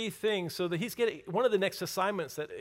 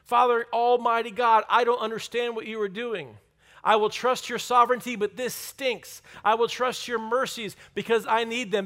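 loud, high, raw plea to God, hurting but respectful; honest prayer to God; 5 semitones higher, 7 dB louder than usual. Shown as a demonstration. Preacher voicing a believer praying to God in pain, loud and high. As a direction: cry out to God, frustrated yet reverent. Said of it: "respectful with him".

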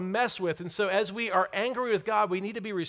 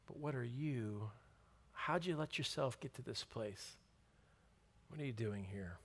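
loving, gentle; 5 semitones lower, 7 dB quieter than usual. A narrator's reading. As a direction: ask gently, like a loving father. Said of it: "In a more loving way this time".

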